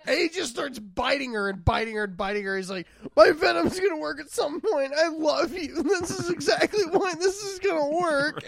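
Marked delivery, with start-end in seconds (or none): 3.1-8.4 high, frantic impression of a pleading lover; half-laughing melodramatic desperation; mock-desperate vampire. Acting out Edward's desperate revival attempt.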